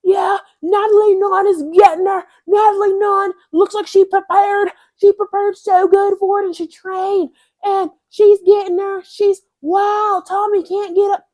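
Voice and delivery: high, shouted mock British commentator, hammed up. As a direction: ham up an excited British fight commentator, loud and high. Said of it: "the fucking commentary with the U K people".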